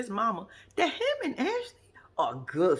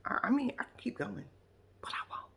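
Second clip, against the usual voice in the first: whispered aside, trailing off; 3 semitones higher, 6 dB quieter than usual. Real, not acted. To viewers, teasing more reasons, whispered. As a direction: drop to a whisper, holding back.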